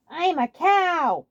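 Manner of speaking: high, loud and drawn-out proud cry; excited exclamation. Acted. Waluigi proudly showing off his cow costume, high slow cry. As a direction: announce it with giddy pride. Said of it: "Waluigi exclaimed".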